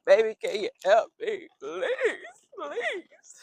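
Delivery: hammed-up plea, climbing to a high, thin please; playful begging. Sniffling vlogger asks baby for help, mock pleading. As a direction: beg with exaggerated helplessness.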